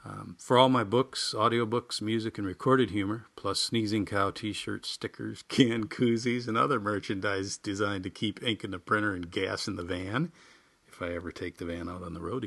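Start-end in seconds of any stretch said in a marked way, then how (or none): none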